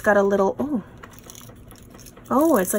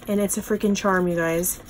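normal talking voice

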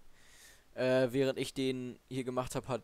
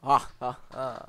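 loud staged laugh; hammy showman laugh; mock laugh; 7 dB louder, slower than usual. Mock laugh after TV act.

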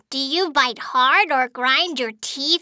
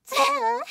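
giggle; 8 semitones higher, 4 dB quieter than usual. Acted. Doctor giggles at patient.

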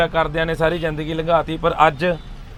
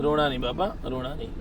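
very soft, falling to a murmur; consoling; 4 semitones lower, 8 dB quieter than usual. Real, not acted. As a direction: tenderly soothe through someone's tears. Consoling a wailing old man. (ਹੌਲੀ, ਪਿਆਰ ਨਾਲ ਦਿਲਾਸਾ)

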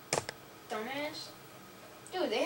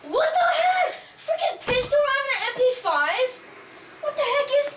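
high furious yelling; screaming at the screen; raging gamer; 11 semitones higher, 5 dB louder than usual. Real, not acted.

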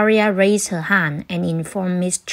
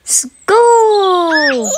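high, loud joyful cry of school; a child bursting with excitement; thrilled; 11 semitones higher, 8 dB louder than usual. A narrator's reading. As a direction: shout it with glee.

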